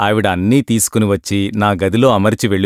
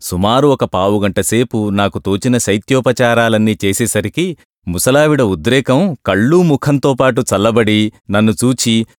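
ordinary delivery

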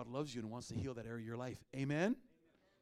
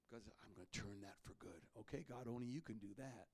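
hushed prayer; 9 dB quieter than usual. Real, not acted. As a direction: drop to a near-hushed plea to God. Quiet prayer after loud preaching.

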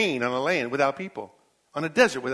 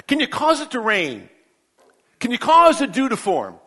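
higher, louder rapid-fire challenges; full-force preaching; 8 semitones higher, 8 dB louder than usual. Acted. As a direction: pound each question at Job. Preacher hammering questions at Job.

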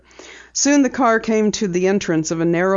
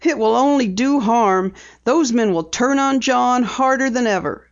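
higher, anxious plea; pleading; 6 semitones higher than usual. A narrator's reading. Woman begs editor not to publish, pleading. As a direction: plead with mild urgency. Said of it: "Missus Preston pleaded".

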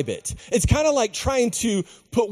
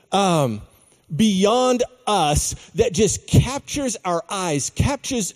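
normal talking voice

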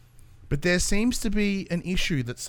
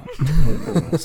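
laugh; 7 dB louder than usual. Real, not acted. Laughing at guest greeting.

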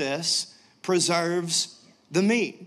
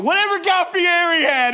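higher, louder eager order; ordering from the waitress, higher and louder with glee; excited; 10 semitones higher, 5 dB louder than usual. Retold or imitated. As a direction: gush it like a superfan. Ordering like a superfan.

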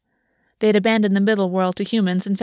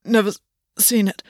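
gasped. Acted. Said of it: "Gwen gasped".

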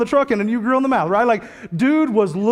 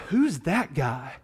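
hushed puzzled question; 3 semitones lower, 5 dB quieter, much slower than usual. Retold or imitated. Old man staring at screen.

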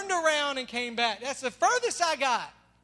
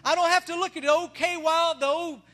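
loud, rising preacher's call to the crowd; full-force preaching; 4 semitones higher, 6 dB louder than usual. Acted. Pastor preaching forgiveness to his church, loud and fervent. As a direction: preach it out to the congregation. Preaches new creature, building.

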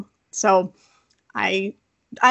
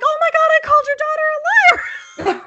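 high, loud, fast squeal; hammed-up shrieking dismay; mock horrified realization; 4 dB louder, much faster than usual. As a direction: squeal it out in mortified haste. Acts mortified teacher.

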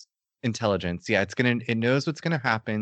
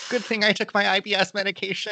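nerdy guy voice; 10 semitones higher, faster than usual. Acted. Continues the nerdy physicist bit.

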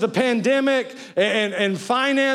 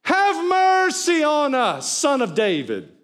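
crying out; 5 semitones higher, slower than usual. Retold or imitated. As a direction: call out desperately for mercy. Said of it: "they cried out".

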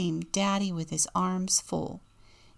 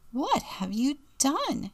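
high-pitched, contained outcry; mildly acted mother's dismay; dismayed cry; 8 semitones higher than usual. A narrator's reading. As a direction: cry it high without shouting. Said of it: "she cries".

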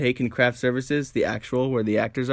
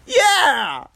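much higher, loud yeah; excited cheer; 6 dB louder than usual. Pretend. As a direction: whoop it out. Imagined cheer.

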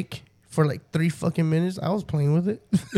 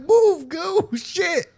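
much higher, frustrated outburst; exasperated retold order; 12 semitones higher, much slower than usual. Speaker retells a second try at the stuck door, high exasperated order. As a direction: re-enact losing patience, pitch jumping way up.

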